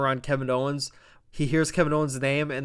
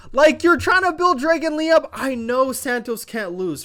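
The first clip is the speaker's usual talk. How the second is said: much higher, louder rant; excited rant; 11 semitones higher, 7 dB louder than usual. Real, not acted. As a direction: complain loudly in disbelief. Ranting excitedly.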